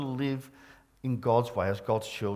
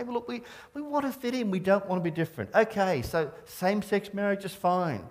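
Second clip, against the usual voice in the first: higher-pitched, hurried imitation of people giving in; mock appeasing; 7 semitones higher than usual. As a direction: imitate a church eager to fit in, quick and high. Voicing churches eager to fit in.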